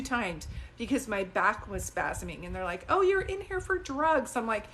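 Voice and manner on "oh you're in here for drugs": mocking staff. Mocking dismissive hospital staff.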